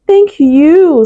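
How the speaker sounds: thankful goat voice; grateful goat. Acted.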